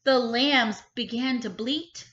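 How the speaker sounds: ordinary delivery, nothing marked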